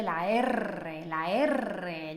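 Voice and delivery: "la R" with a long trilled rr, drawn out; rolled R. Shown as a demonstration. Teacher introducing the first common error, rolled R. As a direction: roll the rr long in the letter name "R".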